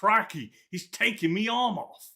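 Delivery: high, loud, slow Australian yelp; Steve Irwin impression. Retelling the movie scene, a hammed-up Australian yell. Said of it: "And he goes".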